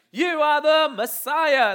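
loud, slow, high proclamation; exuberant declaration. Retold or imitated. Peter boldly declaring to Jesus, exuberant and loud. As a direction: proclaim it with full conviction.

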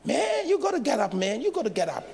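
a casual American man telling him to get up; American street voice. Retold or imitated. A passerby in the snow tells the preacher to get up, in an American accent. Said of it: "And you know the American".

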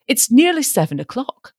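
put-on a testy old father fretting; fussy father. A narrator's reading. Impatient father voiced.

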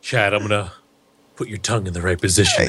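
low, fast clinical voice; stern therapist. Pretend. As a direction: speak like a strict therapist. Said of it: "all I can imagine is".

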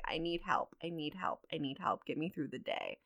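low, hushed, repeated plea; quietly imitating her own praying; retold desperate prayer. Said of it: "I'm praying all throughout this time frame".

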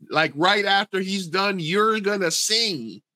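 retold exasperation, slow and high; exasperated insistence. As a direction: explain it to someone who won't listen. Acted exasperated reply to drunk customer.

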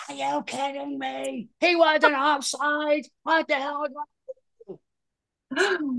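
high, slow, loud outraged yell; screaming parent. Retold or imitated. Sideline parent yells at the ref, high and loud. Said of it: "the video of the parents going".

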